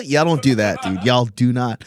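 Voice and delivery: usual speaking voice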